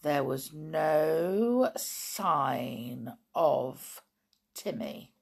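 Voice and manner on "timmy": ordinary delivery, nothing marked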